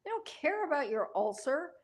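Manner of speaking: high, animated retold scolding; mock exasperated lecture. Telling sister the kids don't care, mock exasperated. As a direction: press the point high, breaking into a laugh.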